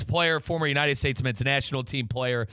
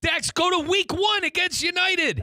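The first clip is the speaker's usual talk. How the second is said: worked-up rant; 13 semitones higher than usual. Real, not acted. Host ranting about VAR calls.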